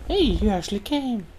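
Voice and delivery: put-on cheery high girl, surprised you came; bird girl voice. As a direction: play the young bird girl, pleased.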